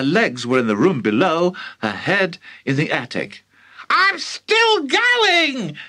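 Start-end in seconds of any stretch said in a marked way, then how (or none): 3.9-5.8 very high, slow screechy crone; old screechy voice. Acted. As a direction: screech down from the attic. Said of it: "the old screechy voice from above".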